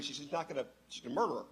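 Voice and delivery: ordinary talk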